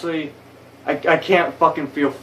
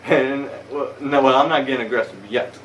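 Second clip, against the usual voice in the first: normal talking voice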